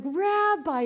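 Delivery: high, bright calling out the rabbi's name; happy call. Retold or imitated. Happy farmer greets the rabbi, voice jumping up. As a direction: call out to the rabbi with pure joy. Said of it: "He is so happy".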